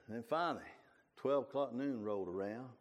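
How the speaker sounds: ordinary delivery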